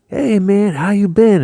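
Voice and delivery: high, loud friendly greeting; imitating an artist greeting an old friend; Sam's greeting. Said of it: "he gives me this look like".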